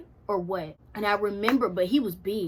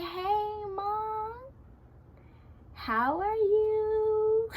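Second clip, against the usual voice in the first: high, drawn-out, overly sweet hello; singsong mock-innocent phone greeting to mom; fake casual greeting; 10 semitones higher, 4 dB quieter, much slower than usual. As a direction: stretch it out, high and falsely breezy. Acts out casual call, hiding panic.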